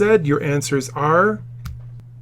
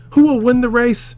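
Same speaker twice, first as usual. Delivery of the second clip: pitched up to read the quote; teacher voicing a quote; quoted question; 9 semitones higher, 4 dB louder than usual. Shown as a demonstration. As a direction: lift the voice high for the quoted question.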